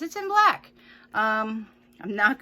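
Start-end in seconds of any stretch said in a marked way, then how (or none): 0.0-0.7 high, loud mock amazement; sarcastic. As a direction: sneer it out in fake wonder. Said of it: "Imagine that, you guys".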